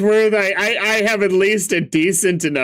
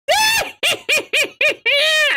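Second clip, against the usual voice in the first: high shrieking witch cackle. Acted. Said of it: "I'm giving her a witch voice".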